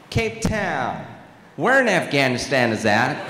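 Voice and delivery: baffled American asking, mock-clueless; confused American accent. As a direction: imitate a clueless American's question. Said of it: "confusion on the Americans' faces".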